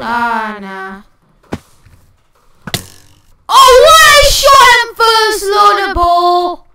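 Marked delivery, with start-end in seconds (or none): 3.5-6.7 loud, high outraged protest; raging gamer. Real, not acted. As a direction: yell in disbelief. Raging at losing a kill.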